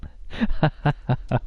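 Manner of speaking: airy giggle under the breath; breathy laugh. Real, not acted. Streamer amused by game line.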